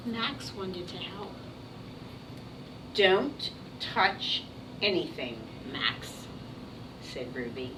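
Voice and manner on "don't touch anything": ordinary talk, nothing marked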